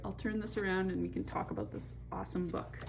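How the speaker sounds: plain reading voice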